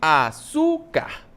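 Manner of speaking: "Açúcar" in slow, separate syllables, dwelling on çú; teacher showing the stressed çú in "Açúcar"; split into syllables. Shown as a demonstration. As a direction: say "Açúcar" slowly as a-çú-car. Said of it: "a sílaba tônica".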